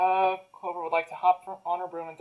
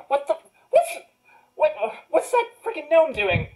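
higher, half-laughing what the; startled outburst; 8 semitones higher than usual. Acted. Wizard spotting the gnome at his door, startled. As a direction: caught off guard, sputtering.

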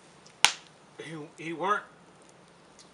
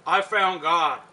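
loud quoted testimony, hit hard; big, emphatic declaration; proclaiming; 9 dB louder, slower than usual. Acted. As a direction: proclaim it boldly, as the father's refrain. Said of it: "he used to say".

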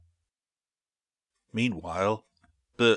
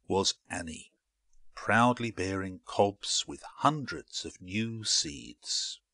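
usual speaking voice

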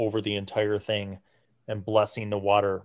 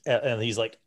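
ordinary delivery

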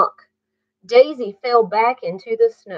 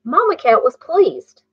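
usual speaking voice

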